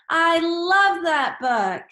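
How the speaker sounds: high, loud, slow, love stressed; excited. Shown as a demonstration. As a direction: burst out with delight over the book. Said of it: "say it with excitement".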